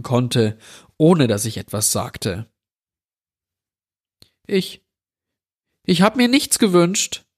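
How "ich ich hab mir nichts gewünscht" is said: put-on high, small frightened voice of a boy; a scared boy answering the stranger, voice trembling; trembling child's voice. A narrator's reading. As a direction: stammer it out in fear. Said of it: "mit zitternder Stimme".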